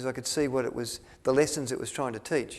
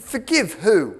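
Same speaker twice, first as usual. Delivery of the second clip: loud, high, indignant challenge; nasty tough guy; 7 semitones higher, 10 dB louder, much slower than usual. Acted. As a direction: fire it back loudly like a punk. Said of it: "he's got a real nasty look".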